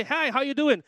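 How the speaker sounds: high, run-together Southern US greeting; American greeting. Retold or imitated. Preacher mimics Southerners greeting complete strangers, American accent. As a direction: imitate a cheery American stranger, words merged into one. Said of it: "they'll come up to you and say".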